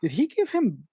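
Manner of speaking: can't believe what you just saw; incredulous question. Retold or imitated. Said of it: "I'm like".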